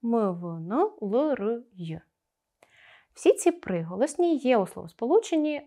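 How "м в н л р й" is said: «м», «в», «н», «л», «р», «й» as bare sounds with a short schwa; isolated sonorant sounds. Shown as a demonstration. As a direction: list «м», «в», «н», «л», «р», «й» one sound at a time. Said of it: "тоді творяться сонорні приголосні".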